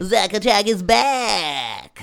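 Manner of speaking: slow, loud wrestling-announcer call; hype announcer. Acted. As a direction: boom out the intro like a ring announcer. Hyping up the returning co-host.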